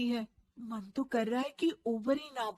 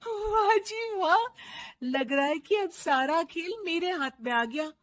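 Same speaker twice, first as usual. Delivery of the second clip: gleeful; 5 semitones higher, 5 dB louder than usual. Acted. Daughter-in-law gloats over her new power, gleeful. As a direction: savour the victory. Triumphant bahu.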